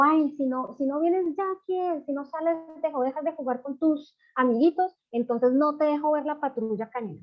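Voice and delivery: high-pitched mock scolding mother; scolding parent. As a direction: threaten the child, voice pitched well up. Scolding parent threatens child. (regaño fingido de mamá, con voz aguda)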